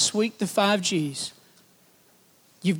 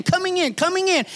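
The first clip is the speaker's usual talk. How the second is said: usual speaking voice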